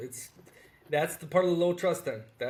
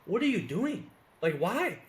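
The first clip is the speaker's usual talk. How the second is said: higher, incredulous demand; exasperated; 4 semitones higher, slower than usual. Retold or imitated. Expat retelling his frustration with locals, higher and exasperated. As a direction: throw your hands up at the absurdity. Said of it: "You're like".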